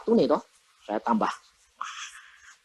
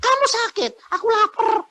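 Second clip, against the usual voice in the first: put-on child's voice. Healed child speaking.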